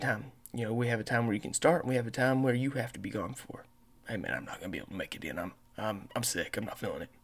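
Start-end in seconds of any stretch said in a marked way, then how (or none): 4.1-7.1 low, quiet, mumbled sick-caller imitation; hammed-up sick call, quiet and lower; mock sick voice. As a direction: play the groggy sick employee, barely there. Driver calling in sick.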